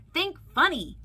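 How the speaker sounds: high, loud urging call. A narrator's reading. Mike urging monsters to be funny, calling out. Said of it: "he called".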